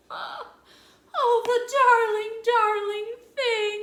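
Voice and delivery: high, loud, tearful gushing. A narrator's reading. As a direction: melt over the darling piglet. Missus Watson gushes over piglet.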